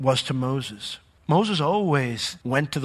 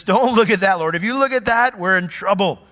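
pleading Moses; 5 semitones higher, 5 dB louder than usual. Retold or imitated. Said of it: "He says".

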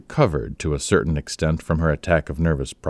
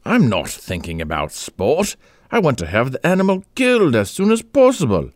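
rising exasperated outburst, much higher by the end; fuming; 8 semitones higher than usual. A narrator's reading. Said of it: "fumed Egbert".

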